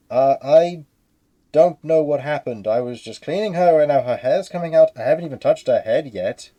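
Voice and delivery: loud, alarmed protest; flustered cleaner. Acted. Cleaner explains falling hair to the captain, loud and flustered.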